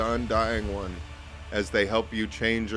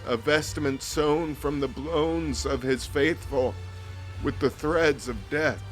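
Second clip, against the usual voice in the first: higher, grave priestly voice; solemn vizier; 5 semitones higher than usual. Acted. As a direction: speak as an ancient cult priest.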